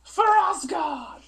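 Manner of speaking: high, slow drawn-out war shout; battle cry. Pretend. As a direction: bellow it like charging into battle. Battle cry while jumping.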